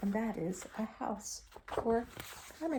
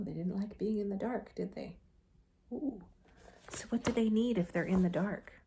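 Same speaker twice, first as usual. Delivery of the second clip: plain reading voice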